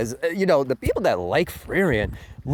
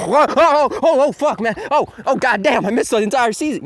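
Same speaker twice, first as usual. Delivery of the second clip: higher, louder mock dismay; mock waking viewer; 10 semitones higher, 7 dB louder than usual. Viewer waking up after the whole season, mock higher dismay. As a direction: jolt awake and curse in mock panic. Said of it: "you're like".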